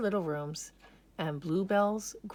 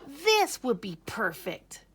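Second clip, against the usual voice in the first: hopeful delight; 5 semitones higher, 4 dB louder than usual. A narrator's reading. Bunny pleased.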